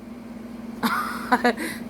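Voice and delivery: soft, breathy laugh. Real, not acted. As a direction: laugh quietly at the story. Laughs at her retold exchange.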